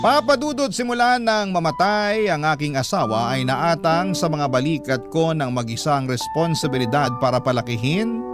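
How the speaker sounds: usual speaking voice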